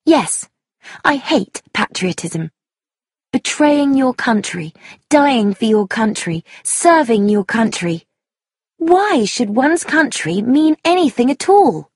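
high, impassioned, heavily stressed speech; vehement young woman. Acted. Young woman railing against patriotism, vehement. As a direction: argue hotly, hammering each phrase. Sheila argues passionately.

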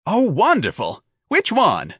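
high, gushing enthusiasm; enthusiastic cry. A narrator's reading. Office manager delighted to get an applicant, enthusiastic. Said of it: "the man cried enthusiastically".

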